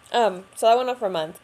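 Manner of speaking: ordinary delivery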